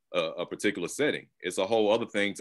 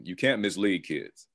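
usual speaking voice

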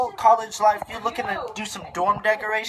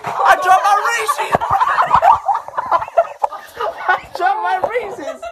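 laughing squeal; 12 semitones higher, 4 dB louder than usual. Real, not acted. Dropping snack from bunk.

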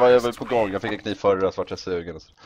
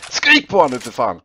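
loud; 3 semitones higher, 9 dB louder than usual. Real, not acted. Urging teammate loudly.

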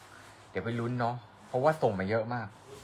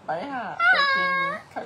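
cute high voice; 5 dB louder than usual. Pretend. Cute kawaii voice after kawaii talk.

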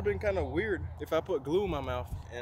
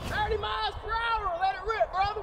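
high, hyped-up shout; hype shout. Real, not acted. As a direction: yell it like launching the big test. Hypes the launch of the test.

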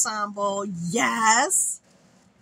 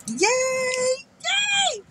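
very high squealed yay; gleeful cheer. Real, not acted. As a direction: cheer in a tiny, piercing squeal. Cheering over gift received.

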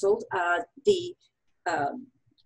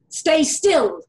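loud, raised cry within a poem; declaimed shout; performed scream; 5 semitones higher, 8 dB louder than usual. A narrator's reading. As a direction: cry it out dramatically, short of a full scream. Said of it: "he screamed at time".

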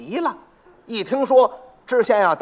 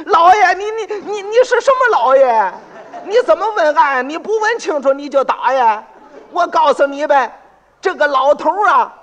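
very high, loud indignant outburst; indignant protest; 8 semitones higher, 7 dB louder than usual. Acted. Beaten pot seller protests to the magistrate, shrill and outraged. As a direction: jump up and shout your grievance.

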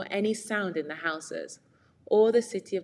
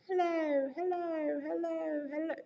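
high echo, softer each time, dying away; fading echo; 10 semitones higher, 5 dB quieter than usual. Shown as a demonstration. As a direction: let each hello fade like a cave echo. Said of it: "you might hear".

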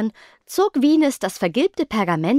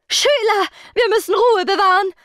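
much higher and louder, frantic plea; panicked pleading; 11 semitones higher, 5 dB louder than usual. A narrator's reading. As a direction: beg for calm while panicking. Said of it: "flehte Miss Schülerschreck so panisch". (panisch flehend, hoch und laut)

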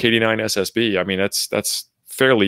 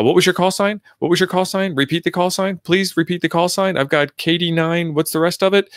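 high, impatient, repeated demands; whiny, exasperated radio operator; cranky operator; 8 semitones higher than usual. Retold or imitated. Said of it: "they get cranky about is like".

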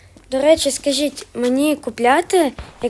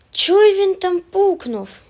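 mock; 8 semitones higher, 6 dB louder, slower than usual. Mocking enemy's noise.

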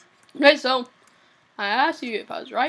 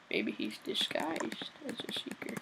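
whisper; 13 dB quieter than usual. Acted. Suspicious aside during hide and seek.

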